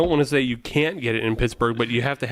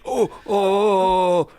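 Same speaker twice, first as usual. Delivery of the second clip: higher, long drawn-out "oh" moans; mock moans; 6 semitones higher, much slower than usual. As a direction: imitate slow, teasing moans. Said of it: "and then they're like".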